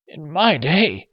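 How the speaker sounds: slow, high, strained gasp. Acted. Old man after a coughing fit, gasping it out. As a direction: struggle to get the words out. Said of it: "he managed to gasp".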